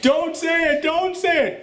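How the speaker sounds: high-pitched mock cartoon plea; cartoon character warning. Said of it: "Freakazoid shows up and they're like".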